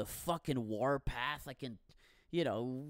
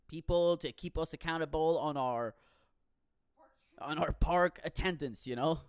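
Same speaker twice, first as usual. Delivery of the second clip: ordinary delivery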